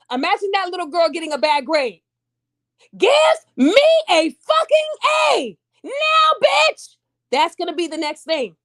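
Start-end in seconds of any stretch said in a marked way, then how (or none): 3.0-7.0 high, drawn-out shouted demand; angry shout. Acted. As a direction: yell it at the teacher like a furious kid. Imagined child cussing out teacher.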